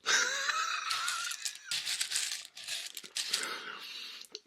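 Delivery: long high breathless laugh; real laughing fit, mostly breath; real wheezy laughter. Real, not acted. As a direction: crack up helplessly at your own story. Laughs at his own punchline.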